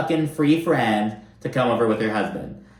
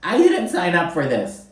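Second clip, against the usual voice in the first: her line thrown back in a higher, mocking voice; mocking Shannon; 6 semitones higher than usual. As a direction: parrot her complaint back with scorn. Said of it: "I'm like, "Oh, Shannon Beador".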